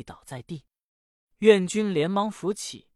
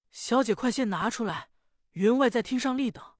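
put-on higher, urgent servant girl's voice; hurried maid; 5 semitones higher than usual. Maid urges the young lady to bring the red garment, hurried and higher. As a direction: rush the news up the stairs.

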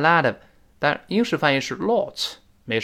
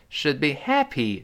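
happy stressed, should be swallowed; slower than usual. Shown as a demonstration. Teacher showing the stress on happy. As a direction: land the weight on happy. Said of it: "在这重读了".

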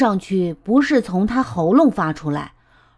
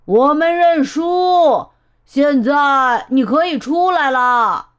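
high, slow, carrying shout; calling out; 5 semitones higher, 4 dB louder, slower than usual. A narrator's reading. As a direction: holler each phrase, stretching the ends. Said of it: "艾玛叫道".

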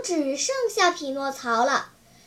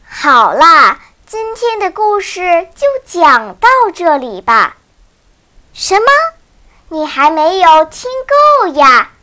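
cheerful outro; 14 dB louder than usual. Real, not acted. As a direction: call out the goodbye and ask them to follow. Loud upbeat outro to listeners.

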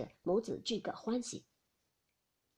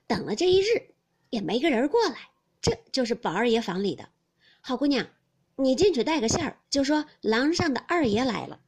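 raised, louder servant's plea at the door; eager servant; 3 semitones higher, 8 dB louder than usual. Acted. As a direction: call out to get the maid's attention. Servant Beiming pleads at door. (提高声音恳求的小厮)

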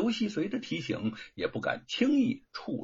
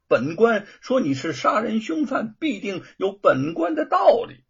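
angry; 6 semitones higher, 5 dB louder than usual. Acted. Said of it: "范谷生气地说".

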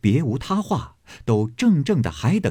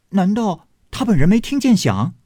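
puzzled question; 9 semitones higher, 4 dB louder than usual. Acted. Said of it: "湘云道".

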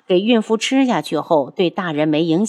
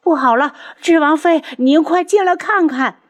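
much higher and louder panicked midwife; frantic yelling; 9 semitones higher, 4 dB louder than usual. A narrator's reading. Midwife calling the princess inside, high panicked yell. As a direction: scream for help in a panic. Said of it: "稳婆在里面大喊大叫着".

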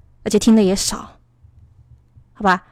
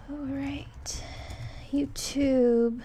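much softer half-whispered muttering while searching; soft whisper to yourself; muttering to self; 5 semitones higher, 16 dB quieter, slower than usual. Real, not acted. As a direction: murmur it under your breath to yourself.

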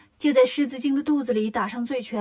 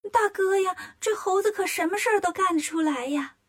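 worried; 7 semitones higher than usual. A narrator's reading.

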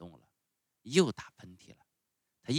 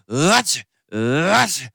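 loud acted sneeze; explosive, voiced "ah-choo"; two sneezes; 7 semitones higher, 14 dB louder, slower than usual. A narrator's reading. Showman interrupted by his own sneezes, loud and explosive. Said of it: "又打了两个喷嚏".

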